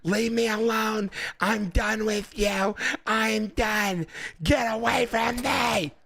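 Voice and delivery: high, slow, whiny mock woman's voice; mimicking a hysterical lady. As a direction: whine it high and drawn-out like the lady yelling at the cop. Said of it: "she's like".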